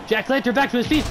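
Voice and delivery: ordinary talk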